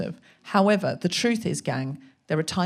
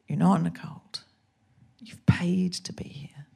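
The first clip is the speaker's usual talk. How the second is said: conscience voice; slower than usual. Retold or imitated. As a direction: murmur it like a calm inner conscience. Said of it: "my conscience went".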